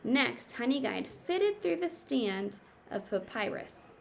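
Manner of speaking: normal talking voice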